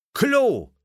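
very high, sudden triumphant cry; called out. A narrator's reading. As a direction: blurt the rhyme out high. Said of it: "rief Thomas". (hell herausgerufen)

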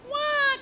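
loud, high acted baby cry; high mock-crying "wah"; baby's wail. A narrator's reading. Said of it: "cried baby".

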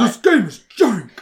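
high, loud blurted verdict; playful shout. Pretend. Co-host interrupting the hedged opinion, shouted. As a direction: shout it over the other speaker.

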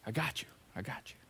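soft, breathy whisper of comfort; whispered reassurance. Pretend. Jesus comforting a worried follower, in a soft whisper. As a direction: hush it like a secret promise. Jesus's promise repeated as a hush.

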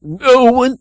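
high cracking start; a voice that breaks too much; overdone cracked villain voice. Pretend. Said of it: "too much cracking in the voice".

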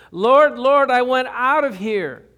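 loud, high anguished cry of a burning woman; despairing cry. Acted. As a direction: cry out for release from the flames. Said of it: "cries and groans of despair".

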